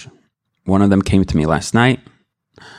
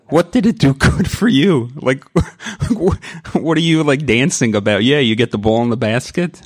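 higher, laughing teasing question; laughing wife; 6 semitones higher than usual. Retold or imitated. Wife teasing husband about basketball, laughing. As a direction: tease through laughter like his wife. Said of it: "I remember my wife telling me".

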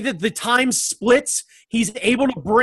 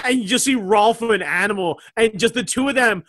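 ordinary delivery

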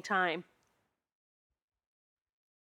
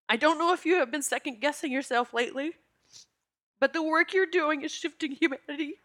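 reading a letter through real tears, voice lifted; reading a friend's loving email while tearing up; tearful reading; 6 semitones higher, 3 dB louder than usual. Real, not acted. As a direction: hold back tears as you read it aloud. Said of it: "that's why I'm tearing up right now".

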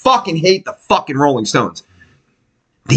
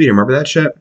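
normal talking voice

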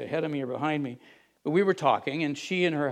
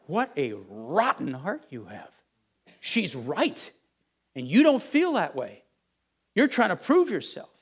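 slow and hushed at first, then rising higher and louder; self-rebuke; 3 semitones higher, 4 dB louder than usual. Retold or imitated. Preacher's inner voice scolding his own heart, building.

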